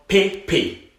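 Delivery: "P P" with closed "ay" vowels, pay-pay; unaccented vowel. Shown as a demonstration. Teacher demonstrates the nickname without the accent, "P P" as pay-pay. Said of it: "without the accent, it's called".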